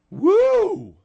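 loud, high-pitched woo; high whoop. Acted. Radio host reacting to his guest's outburst, whooping. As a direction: let out one sharp whoop.

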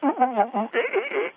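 high monkey hoots, ooh ooh eh eh; mock monkey noises. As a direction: hoot like a monkey to mock him. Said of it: "That's what you did. You went".

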